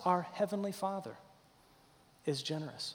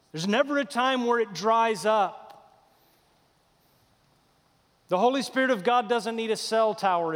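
raised, emphatic sermon delivery; full-force preaching; 6 semitones higher, 6 dB louder, slower than usual. Real, not acted. Preacher declaring the Spirit never runs out, emphatic preaching. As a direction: drive each phrase home to the congregation.

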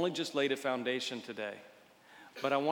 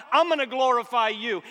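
"I'm" hit high and loud, much higher; soaring declaration; 9 semitones higher, 11 dB louder than usual. Acted. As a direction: lift the repeat to a ringing cry. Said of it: "repeated louder declaration".

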